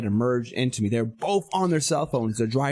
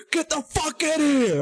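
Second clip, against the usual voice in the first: very high, strained mock rage; retold furious order. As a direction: replay a worked-up roadside threat. Acting out his roadside threat.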